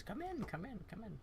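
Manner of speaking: high, soft inviting grandma; sweet grandma voice. Acted. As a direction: coax them in like a sweet old grandma. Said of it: "she just kinda says".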